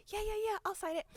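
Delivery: high, light quick assent; casual agreement. Retold or imitated. Idol agreeing to sign it, lightly imitated.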